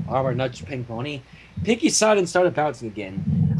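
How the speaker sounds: normal talking voice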